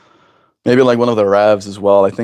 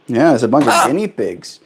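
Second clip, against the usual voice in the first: ordinary talk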